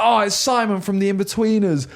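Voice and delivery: high, jeering imitation; mocking heckle. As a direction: mimic a heckler thrilled with his own joke. Mimics heckler's tired joke.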